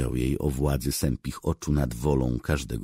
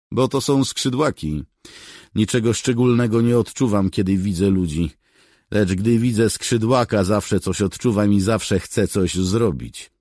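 higher, louder, proud cat's voice; the famous cat answers with lofty disdain; 7 semitones higher, 8 dB louder than usual. Acted. Legendary cat answers the soldier, proud and dismissive.

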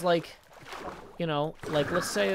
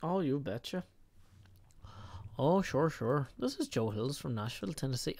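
soft mock Midwestern accent sliding into Irish; badly faked lilting accent; accent drifting Irish; 4 semitones lower, 6 dB quieter than usual. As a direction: try Midwestern, land in bad Irish. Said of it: "I'm back in the Irish Sea again".

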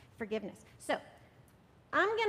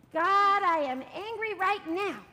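loud, slow, higher angry cry to God; loud angry prayer; 6 semitones higher, 9 dB louder, slower than usual. Shown as a demonstration. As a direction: call it out loud and slowly. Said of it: "Let's try it one more time".

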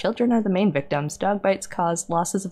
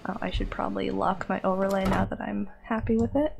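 whispered aside; 6 dB quieter than usual. Real, not acted. Whispered aside while streaming.

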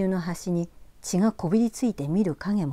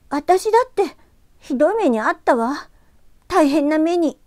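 high, loud hurt retort; upset girl; 10 semitones higher, 8 dB louder than usual. Acted. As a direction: fire back, hurt and upset. ユキが男の子に言い返す. (傷ついて言い返す少女の声)

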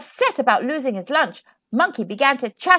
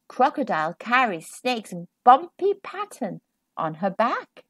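plain reading voice

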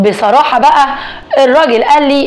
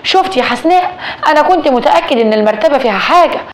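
usual speaking voice

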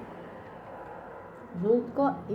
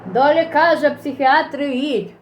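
mocking commenter; 5 semitones higher, 5 dB louder than usual. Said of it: "posypią się komentarze".

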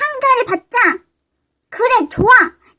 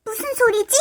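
normal talking voice

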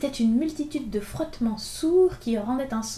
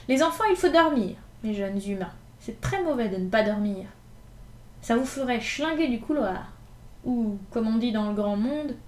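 a cocky gamin laughing off the storm, louder; bold street kid Gavroche; 3 semitones higher, 5 dB louder than usual. Acted. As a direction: crow over the thunder like a fearless street kid. Gavroche cheers the thunder. (Gavroche, gamin effronté, lance bravement ses mots au tonnerre)

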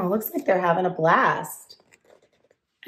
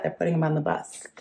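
hushed aside; 3 semitones lower, 6 dB quieter, faster than usual. Real, not acted. Repeated quietly.

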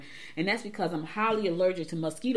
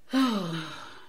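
sigh. Real, not acted. Sighing over the Tulsa shooting.

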